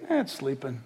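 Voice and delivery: low, soft offhand remark; casual shrug. Retold or imitated. Jesus casually calling death sleep, low and soft. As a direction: toss it off lightly. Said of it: "speak to a dead body and say".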